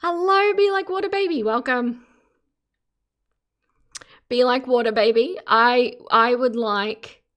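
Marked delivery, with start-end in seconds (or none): 0.0-2.1 excited greeting. Real, not acted. Greeting a chat viewer.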